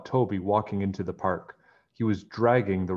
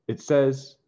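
ordinary delivery, nothing marked

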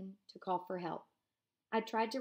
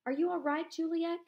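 high, raised call into the dark; 9 semitones higher, 6 dB louder than usual. Acted. Eliezer calling for Juliek in the dark, high and raised. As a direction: call a friend's name across the crush.

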